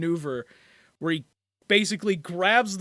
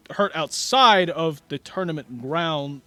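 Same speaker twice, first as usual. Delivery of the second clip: loud, raised announcer voice; tournament announcer; 5 semitones higher, 8 dB louder than usual. Acted. Announcer reining in two fighters, loud and projected. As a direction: project to the whole arena, laying down rules. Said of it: "hear the announcer go".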